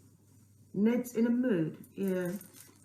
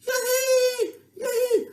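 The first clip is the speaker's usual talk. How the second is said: excited; 8 dB louder than usual. Pretend. Cheering after the song.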